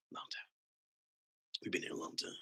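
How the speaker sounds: whispered, soft; whispering. Real, not acted. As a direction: whisper it to yourself. Repeats in a whisper.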